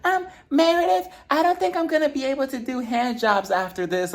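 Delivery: high whiny put-on voice; imitating Whitney. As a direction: squeak it out like a nervous girl. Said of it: "Whitney was just like".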